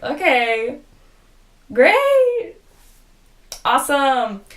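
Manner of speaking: fake-pleased, with a high drawn "great"; mock enthusiasm. Reacting to friends moving against them, mock enthusiasm.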